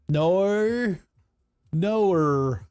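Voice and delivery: drawn-out "No" ending in er; exaggerated R tacked onto "No"; added R ending. Shown as a demonstration. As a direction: stretch "No" into "no-er". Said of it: "add er at the end of a word".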